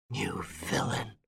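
slow, whispered contempt; hissed insult. Acted. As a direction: breathe it out with hatred. Widow insulting Lupin.

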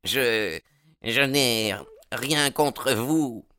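slow, high, halting voice; stammering. A narrator's reading. Baldwin backing down after being throttled, stammering. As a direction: falter as if just released from choking. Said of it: "bégaya Baldwin en se frictionnant la gorge".

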